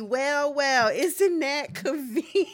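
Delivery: high, drawn-out sassy impression. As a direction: stretch it out high and slow, playfully catty. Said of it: "I'd have been on my [name]".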